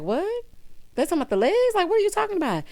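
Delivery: high, confused disbelief; what are you talking about, high and baffled. Retold or imitated. Everybody's baffled reaction.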